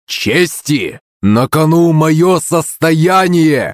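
high, drawn-out angry protest; slow emphatic outburst; heated interruption. A narrator's reading. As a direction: cut in, stretching the words in outrage. Husband cuts in during quarrel.

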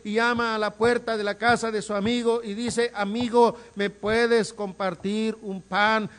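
high, loud, slow sermon delivery; fervent preaching. Acted. Preacher telling the congregation of the midnight friend, fervent preaching. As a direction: press every line home with force.

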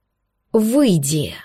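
slow, heavy barked command; barked roar. Acted. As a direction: bark it to shake the glass. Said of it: "рявкнул кореглазый демон".

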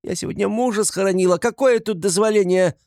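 high, loud protest of a widow; indignant wail. A narrator's reading. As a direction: wail your outrage at a high pitch. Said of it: "завопила Катерина Ивановна". (возмущённо вопит)

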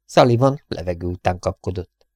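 usual speaking voice

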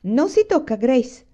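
high, loud warning to a little sister; worked-up scolding, loud and high; excited shriek. A narrator's reading. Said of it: "strillò Carrie, tutta eccitata".

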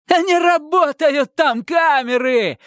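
very high, drawn-out gloating shout; triumphant cry. A narrator's reading. Detainee revealing the cameras are dead, triumphant high cry. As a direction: crow it slowly in triumph. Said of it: "торжествуя крикнул Романов".

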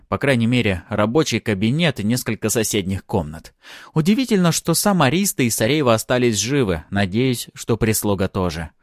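ordinary delivery

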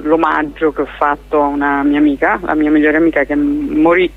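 normal talking voice

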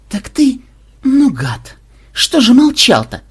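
high, loud indignant scolding; frowning reproach. Acted. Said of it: "нахмурилась Мелисса".

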